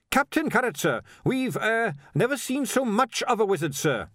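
high-pitched, saluting report; earnest watchman. A narrator's reading. Watchman answers the unclothed wizard, earnestly formal. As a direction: report politely, with one awkward hesitation.

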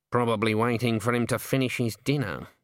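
usual speaking voice

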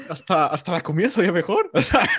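talking through a happy laugh. Real, not acted. Sharing the memory with friend, laughing. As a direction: laugh as you say it. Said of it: "laugh heard".

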